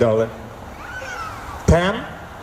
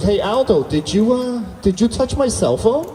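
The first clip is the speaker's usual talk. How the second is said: higher, rising, probing question; suspicious questioning; 6 semitones higher than usual. Retold or imitated. Girlfriend asking if he touched her phone, suspicious questioning. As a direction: imitate a girlfriend fishing for a confession.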